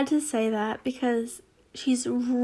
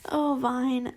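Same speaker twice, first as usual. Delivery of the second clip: usual speaking voice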